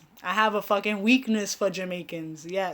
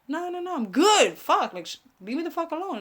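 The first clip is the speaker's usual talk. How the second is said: higher mock exasperated guest; annoyed guest; 8 semitones higher than usual. Guest getting fed up with her offers, mock exasperated higher voice. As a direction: play the fed-up guest, pitched up. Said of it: "And then you'll be like".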